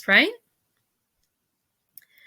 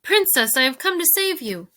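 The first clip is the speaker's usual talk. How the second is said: high, urgent rescuer's call; shout; 6 semitones higher than usual. A narrator's reading. Despereaux tells the princess he has come to save her, shouting. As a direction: call out across the dungeon. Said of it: "Despereaux shouted".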